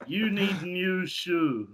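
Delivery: badly faked Italian, slow as a caveman; caveman-like Italian accent. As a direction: put on a clumsy, drawn-out Italian accent. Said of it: "Why does he sound like a caveman?".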